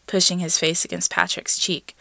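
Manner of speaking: usual speaking voice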